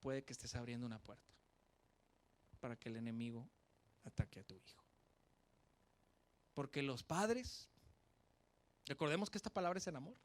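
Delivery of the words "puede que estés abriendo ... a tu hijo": ominous warning. Acted. Preacher warning parents, low and ominous. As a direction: lower and softer, fading into a dark hush.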